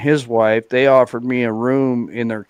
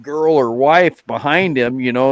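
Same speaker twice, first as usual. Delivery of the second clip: ordinary talk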